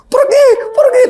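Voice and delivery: very high mock shriek; tiruan cewek mengusir. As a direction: imitate a panicked girl shooing something away. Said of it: "Yang cewek juga". (tiruan jeritan cewek panik, nada tinggi sekali)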